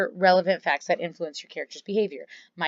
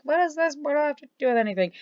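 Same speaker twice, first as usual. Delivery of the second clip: mock confused students; 8 semitones higher, faster than usual. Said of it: "some of you are probably like".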